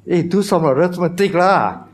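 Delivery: high, teasing reproach; playful scolding. Real, not acted. Teases congregation over the sum.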